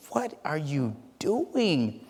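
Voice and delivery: slow, hushed disbelief; dumbfounded. Acted. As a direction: draw it out softly, utterly baffled. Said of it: "they're just dumbfounded".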